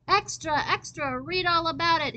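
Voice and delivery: high newsboy shout. Acted. Gabriel waving Tiffany's newspaper, shouting high. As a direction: call it out like a paperboy. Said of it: "he shouted".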